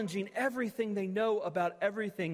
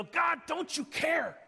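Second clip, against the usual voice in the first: angry shout at God; 10 semitones higher than usual. Acted. A believer shouting at God in chaos, raw anger. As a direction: hurl it up at God, fist raised. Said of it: "shake our fist at God and yell".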